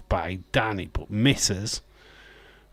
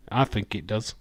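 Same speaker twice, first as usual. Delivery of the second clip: ordinary delivery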